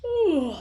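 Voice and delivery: a high, loud sleepy yawn "Ooh". Acted. Narrator voicing the elves' yawn before work, with a high loud yawn. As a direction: yawn it out like a tired elf. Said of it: "The elves yawned".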